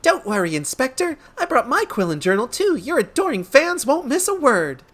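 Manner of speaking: high-pitched, eager sidekick; devoted helper, chirpy and high; adoring assistant. Acted. Voices adoring assistant character.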